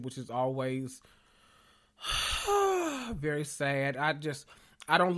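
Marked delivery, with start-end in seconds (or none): 2.0-3.1 sad sigh. Real, not acted. No Scarlet Witch movie announced.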